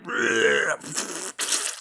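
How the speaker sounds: high mock retch; retching noise. Kid throwing up on the couch, mock retch. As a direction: retch it out like puking. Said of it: "And I'm like".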